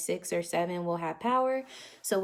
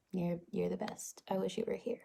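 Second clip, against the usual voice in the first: very soft, tender words to mom; getting emotional; 9 dB quieter than usual. Real, not acted. Daughter telling mom she wishes she were here, getting emotional. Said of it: "why am I getting emotional".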